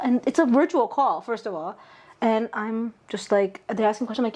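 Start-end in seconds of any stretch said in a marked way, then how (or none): none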